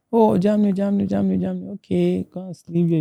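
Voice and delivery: high, sing-song mock reaction; imitated hosts. Hosts react to migrants' hopes of Germany, high-pitched imitation.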